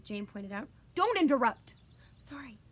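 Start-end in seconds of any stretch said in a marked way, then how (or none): 0.9-1.7 higher, louder snapping father; stern rebuke. A narrator's reading. Father scolding his daughter for interrupting, sharp loud rebuke. 2.2-2.6 much softer sheepish sorry; meek apology. A narrator's reading. Daughter apologizing to her father, meek and soft. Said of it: "looking at her lap".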